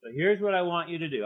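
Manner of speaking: ordinary talk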